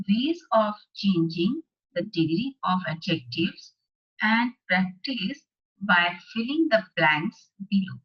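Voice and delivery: slow, deliberate classroom dictation; slow worksheet read-aloud. Acted. Teacher reading the worksheet aloud to class eight students, slow and deliberate. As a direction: read each phrase out slowly for the class.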